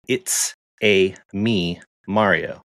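slow, drawn-out mock Italian accent. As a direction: stretch it out like a cartoon Italian plumber, lightly. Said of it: "like an Italian accent".

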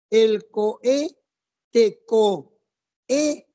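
"coe" broken into co, e as two beats; vowels pronounced apart. Shown as a demonstration. Teacher showing students that "coe" is pronounced separately, vowels apart. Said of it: "se pronuncia separado".

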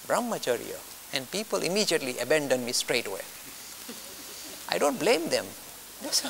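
ordinary talk

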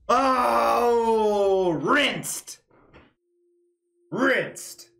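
shocked shout. Real, not acted. Dumped by the game girlfriend.

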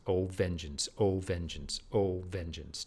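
Oh and vengeance both struck hard, falling; two hard beats. Shown as a demonstration. Teacher showing the spondee's force on Oh, vengeance. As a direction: hammer Oh and vengeance as two equal blows. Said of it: "two hard beats at the beginning".

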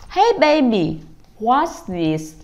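parent playfully quizzing a little one; upbeat parent. Acted. Parent asking baby about a book, sing-song. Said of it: "thì mình sẽ hỏi là".

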